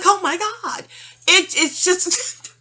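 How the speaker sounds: high, loud, drawn out oh my God; exasperated disbelief. Real, not acted. Reacting to the psychic's news, loud and high. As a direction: stretch it out in disbelief.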